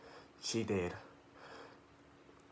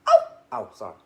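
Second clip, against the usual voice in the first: plain reading voice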